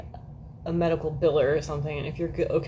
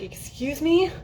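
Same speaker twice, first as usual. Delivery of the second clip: indignant comeback; 5 semitones higher, slower than usual. Retold or imitated. Telling off Mark Zuckerberg, mock indignant and high. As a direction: snap back, high and sassy. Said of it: "I was like".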